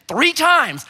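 ordinary talk

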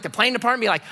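usual speaking voice

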